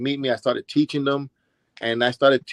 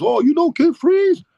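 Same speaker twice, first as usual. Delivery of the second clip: slow, much higher, louder mock amazement; imitating surprised rival; 6 dB louder than usual. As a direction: play the rival caught off guard, drawing it out. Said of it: "He goes".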